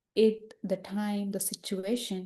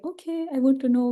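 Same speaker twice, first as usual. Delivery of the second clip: eager curiosity; 4 semitones higher, 3 dB louder than usual. Acted. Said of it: "when we are curious to know something".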